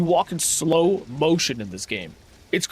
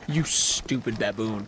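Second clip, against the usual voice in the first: ordinary talk, nothing marked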